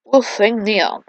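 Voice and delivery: usual speaking voice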